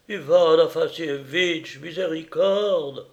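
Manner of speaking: slow, high plea for mercy; drawn-out wail of a servant; despairing cry. A narrator's reading. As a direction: implore your master in despair. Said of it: "s'écria Onissime avec désespoir".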